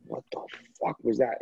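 a stunned near-whisper; hushed shock. Retold or imitated. Driver hit by chest pain, hushed shocked whisper.

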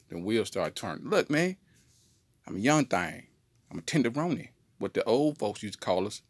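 normal talking voice

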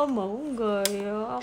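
slow, drawn-out teasing question. Pretend. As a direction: stretch it out playfully. Teasing fans with a playful question.

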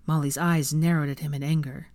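plain reading voice

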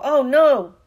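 high, very loud exclamation; commentator's loud outburst of dismay; dismayed cry. Acted. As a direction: wail it as the other side scores. Commentator dismayed at Slytherin score.

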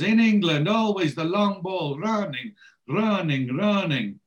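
imitated touchline complaint, pitched high; Sandro shouting. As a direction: start loud, then repeat it in exasperation. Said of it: "and then he shouts more".

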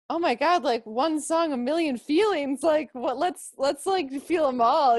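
high, loud burst of enthusiasm; gushing excitement. Acted. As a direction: gush it, overwhelmed by feeling. Acts out own eager reaction.

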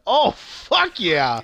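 drawn-out, high, loud exclamation; hyped cheer. Retold or imitated. Viewer cheering the stabbing on screen, hyped. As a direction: relive cheering at the screen, stretched slow and high. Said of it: "I fucking popped off".

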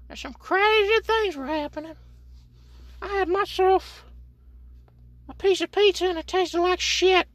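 very high squeaky put-on voice, loud; squeaky cartoon voice. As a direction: squeak it all like a cartoon kid. Sustained squeaky voice bit.

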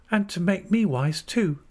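put-on high, timid young wife's voice. A narrator's reading. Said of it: "said Dora timidly".

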